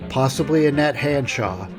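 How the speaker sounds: usual speaking voice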